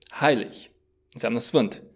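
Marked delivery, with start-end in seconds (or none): 0.1-0.5 "Heilig" ending in a soft ich-sound. Shown as a demonstration. Teacher rounds off the spelling, "Heilig" with final g said as h. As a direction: say "Heilig" as "hai-lich", without a g. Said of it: "G-ul se pronunță H".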